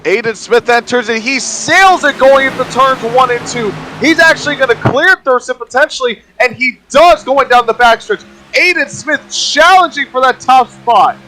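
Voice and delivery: high, excited race commentary; live play-by-play. Real, not acted. Co-commentator calling a pass for first, excited high play-by-play. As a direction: ride the pitch up with the pass.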